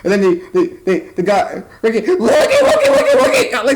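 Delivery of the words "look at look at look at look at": much higher, laughing, imitating a squeal; laughing high-pitched mockery; mock squeaky voice. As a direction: squeak it out like a silly cartoon guy. Mocking a character's squeal.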